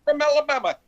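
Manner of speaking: high, loud, fast booming sportscaster call; Keith Jackson impression. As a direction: belt it out like an excited college football announcer. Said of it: "he would just break into Keith".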